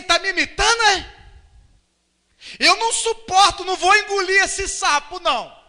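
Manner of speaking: loud, high-pitched mock outrage; indignant outburst. Vain person who won't swallow being imitated, loud indignation. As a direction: act out bristling at being copied.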